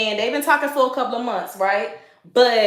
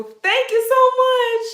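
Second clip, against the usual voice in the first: high, slow, delighted thank-you; gushing thanks; 12 semitones higher, 4 dB louder, slower than usual. Real, not acted. Thanking a viewer for calling her beautiful, high and gushing. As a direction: beam it back at the compliment.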